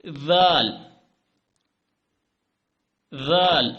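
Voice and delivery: دال then ذال, each said slowly and loud; letter drill. Shown as a demonstration. Teacher drilling the letters for viewers. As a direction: model each letter name clearly. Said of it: "ذال کو بولنے کے لیے".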